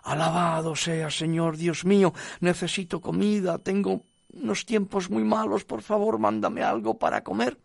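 high, pleading cry to heaven; acted crying out. Woman on her porch begs God for food, crying out. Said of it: "fue al porche y gritó".

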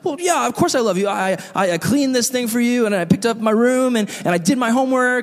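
high-pitched, stammering list of excuses; defensive. Acted. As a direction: pile up proof in your own defense, stumbling between items. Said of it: "we start listing what we've done".

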